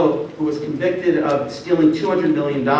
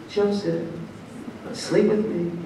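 soft, slightly raised coaxing voice; hushed, teasing come-on; mock seductress; 8 dB quieter, slower than usual. Potiphar's wife tempting Joseph, softly seductive.

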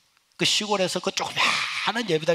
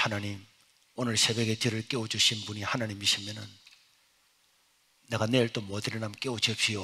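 hushed prayer; 8 semitones lower, 6 dB quieter than usual. Retold or imitated. Retold bedtime prayer to God.

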